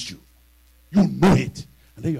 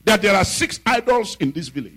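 louder, higher proclamation; bold announcement; 8 semitones higher, 6 dB louder than usual. Retold or imitated. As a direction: announce it boldly to the church. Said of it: "He said".

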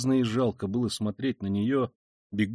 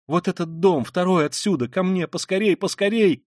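high, urgent entreaty; pleading; 6 semitones higher, 4 dB louder than usual. A narrator's reading. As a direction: beg for help, growing more insistent. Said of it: "умоляла Соня". (настойчиво умолять)

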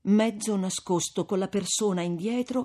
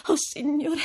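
high, pained sigh of prayer; dolorous female voice; sorrowful exclamation; 9 semitones higher than usual. A narrator's reading. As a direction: cry out in grief, unforced. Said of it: "esclamò dolorosamente Lucia".

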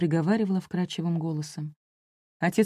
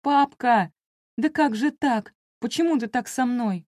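high, raised plea, loudest at the start; anguished cry; 6 semitones higher, 3 dB louder than usual. A narrator's reading. Daughter to the father who shook her, crying out. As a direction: cry out in hurt disbelief. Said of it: "я закричала".

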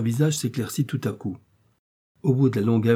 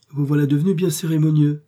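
a touch of sourness; 5 semitones higher, 6 dB louder than usual. A narrator's reading. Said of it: "avec un peu d'aigreur".

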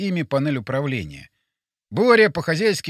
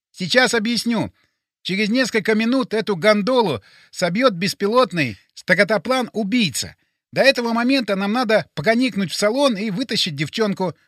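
high, insistent character voice; brief them urgently, pitched up; urgent Boris; 5 semitones higher, 4 dB louder than usual. Acted. Plays Boris explaining urgently.

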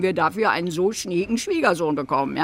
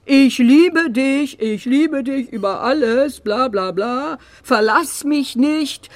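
mocking recital; 4 dB louder than usual. Mother recites Harry's love letter to the writer, mocking recital. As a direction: mimic the lovelorn writer with contempt.

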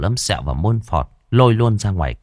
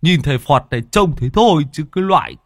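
higher, jokey needling voice; teasing crony; 6 semitones higher than usual. Acted. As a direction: tease him in a raised character voice. Teasing Môn Phọt in story. (giọng cao trêu chọc)

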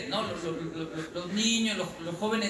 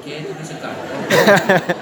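slow, much louder laughing hush; laughing mock warning; 2 semitones lower, 12 dB louder, much slower than usual. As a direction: stop your partner, laughing. Partner stops the haggler.

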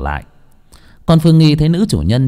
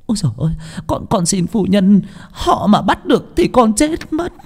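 high, stammering plea that climbs and drags out; panicked maid pleading for her life; frantic begging; 10 semitones higher than usual. Acted. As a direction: beg in terror, voice rising into a wail. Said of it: "van xin rối rít".

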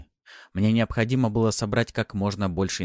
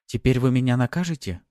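higher, coy and teasing; coy maid; 5 semitones higher, 4 dB louder than usual. A narrator's reading. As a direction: tease him coyly.

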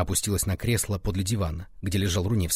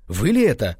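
a short, loud cry of astonishment; astonished cry; 2 semitones higher, 6 dB louder than usual. A narrator's reading. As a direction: cry it out in disbelief. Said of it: "вскричал Руневский".

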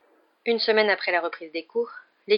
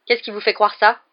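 louder, dry challenge; curt question; 3 semitones higher, 7 dB louder than usual. A narrator's reading. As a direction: ask it sharply, guard up. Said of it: "dit-il sèchement". (question posée sèchement)

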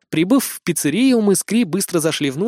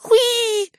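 very high delighted squeal; gushing squeal. A narrator's reading. Eris overcome by a cute girl, gushing squeal. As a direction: squeal as if choking on cuteness. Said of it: "едва не захлебнувшись от милоты".

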